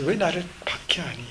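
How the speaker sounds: gently ask, seeking to understand; Jesus gently asking Saul why, whispered softly. Retold or imitated. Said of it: "따지는 음성이 아니라 ... 이해하려는 음성".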